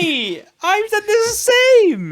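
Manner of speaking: thrilled, high-pitched; excited. Real, not acted. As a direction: burst out in glee at the match. Delighted they chose alike.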